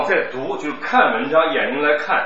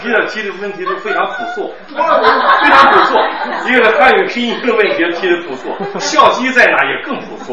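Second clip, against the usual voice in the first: laughing teasing; 9 semitones higher, 11 dB louder than usual. Real, not acted.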